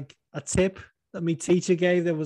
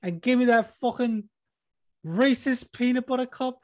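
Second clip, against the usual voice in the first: Liverpool fighter ordering fast food, building up; Darren Till ordering; 10 semitones higher than usual. Retold or imitated. Host as Darren Till ordering at the Dairy Queen drive-thru. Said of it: "here he is at the fucking drive-thru".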